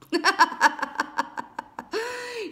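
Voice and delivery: high cackling burst of laughter; hearty laughter. Real, not acted. Reader laughs at taking fertility literally, cackling. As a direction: crack up, ending on a high note. Cracks up at own remark.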